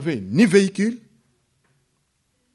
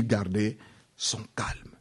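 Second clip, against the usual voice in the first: slow whispered aside to the congregation; 4 semitones lower, 11 dB quieter, slower than usual. Acted. Preacher whispers slowly to his congregation about keeping calm. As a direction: breathe it out slowly, a secret.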